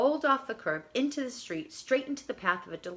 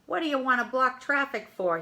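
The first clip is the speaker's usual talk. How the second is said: a touch louder and higher, calling from the car; light irritated call across traffic; mild call-out; 5 semitones higher, 5 dB louder than usual. A narrator's reading. Limo driver calling out from his window, mildly annoyed. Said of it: "he called out".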